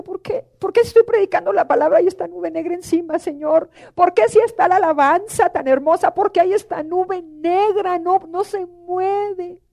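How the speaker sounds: retelling a baffled plea to God; anguished questioning. Retold or imitated. Retold anguished questioning of the Lord. (súplica angustiada, más aguda)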